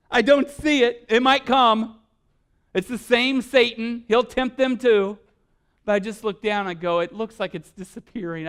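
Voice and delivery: loud, high impassioned sermon; fiery preacher rising to a climax; preaching at full force. Acted. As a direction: build it like a pulpit call to righteous anger. Preaching about his children.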